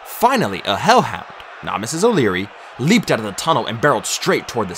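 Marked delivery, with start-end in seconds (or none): none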